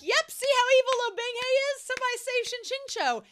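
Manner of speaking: high, loud, hammed-up cry of alarm; mock outrage. Past reader self crying out about evil Lo Binghe, hammed-up high and loud. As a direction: overplay your past self's panic at the villain. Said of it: "I would've been like".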